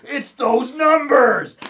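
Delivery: slow, loud, high overacted cry; mock the actor's big eureka moment; loud, drawn-out movie-hero exclamation; hammed-up Nick Cage. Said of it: "And he goes".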